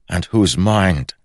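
loud, high defiant challenge; challenging. Acted. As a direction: throw the challenge back hard. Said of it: "challenged Holland".

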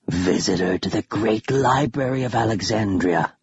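lightly voiced hissing undead; a hint of a hissing ghoul; hissing creature. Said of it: "the thing hissed".

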